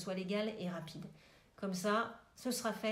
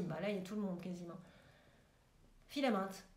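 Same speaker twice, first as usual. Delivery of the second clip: ordinary talk, nothing marked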